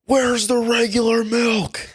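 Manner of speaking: high, slow put-on customer voice; mock demanding customer. Clerk mimics a customer barging in for milk, high slow mock voice. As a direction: ham up a pushy shopper demanding milk. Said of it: "he's flailing his arms in the air and he goes".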